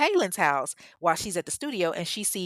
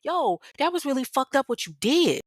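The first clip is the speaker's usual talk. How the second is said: higher, louder retold anger, not a full yell; reading her angry text with some heat; pissed-off text; 7 semitones higher, 4 dB louder than usual. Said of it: "she's pissed off".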